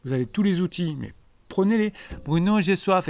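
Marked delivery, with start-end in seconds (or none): 2.2-3.1 put-on higher, louder childish plea to the coach; mock whiny follower. Follower whines to coach Bruno, higher childish plea. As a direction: beg like a kid who won't help himself. Acted sketch of whining follower.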